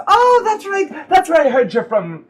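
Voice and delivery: high, loud exclamation of remembering; sudden recognition. Acted. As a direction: burst in as it comes back to you. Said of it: "And she goes".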